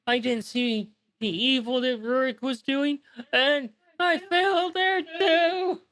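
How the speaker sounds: high tearful plea breaking into a wail on failed; bawling dragon. Acted. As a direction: bawl it, rising to a howl at the end. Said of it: "bawling uncontrollably".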